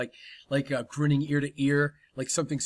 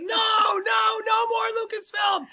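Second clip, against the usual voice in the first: much higher, louder terrified scream; panicked scream; 4 dB louder, slower than usual. Acted. Director screaming no while held down. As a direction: scream for mercy.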